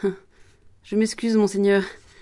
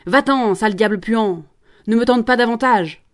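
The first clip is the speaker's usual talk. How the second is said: shouted rebuke; 2 semitones higher, 5 dB louder than usual. Acted. Promoteur acting out the cry Jeanne should have made, loud. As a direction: bellow it to drive the devil off. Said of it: "Il fallait lui crier".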